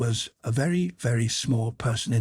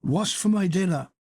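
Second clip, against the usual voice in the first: shouting; 8 semitones higher than usual. Acted. Drunk father shouts at his son for dinner, higher demanding call. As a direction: demand your dinner, banging the table. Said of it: "he banged his fists on the table and shouted".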